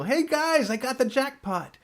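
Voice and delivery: high, whooping announcement; mock gleeful jackpot call; gleeful winner. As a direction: brag about the jackpot. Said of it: "Putt walks out".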